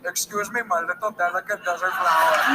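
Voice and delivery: put-on woo her in a gentle Indian accent; an Indian suitor flatters a woman in a mild Indian accent. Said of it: "because I'm Indian".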